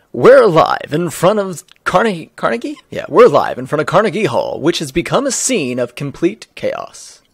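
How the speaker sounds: loud, punched live news report; hammed-up on-the-scene reporter. Reporter announcing live from Carnegie Hall, hammed-up broadcast voice.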